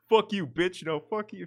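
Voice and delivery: high-pitched mock bickering; mock couple's quarrel. Mimics arguing toxic couple from TikToks.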